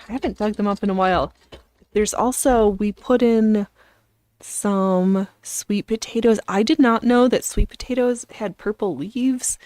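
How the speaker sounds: usual speaking voice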